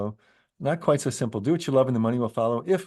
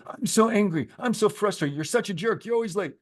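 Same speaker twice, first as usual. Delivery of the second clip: angry outburst; 9 semitones higher than usual. Retold or imitated. Mock outburst to show the unaware reaction, high and heated. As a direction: blurt out the blame at the other person. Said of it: "as opposed to".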